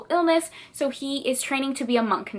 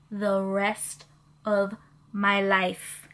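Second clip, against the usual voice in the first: slow emotional repeat; 3 semitones lower, much slower than usual. Real, not acted. Repeats quote, moved.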